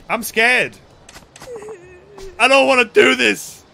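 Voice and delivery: loud, hammed-up frightened cry; mock scared wail. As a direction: wail like a panicking girl. Voicing scared game girl.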